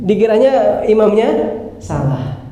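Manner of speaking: ordinary talk, nothing marked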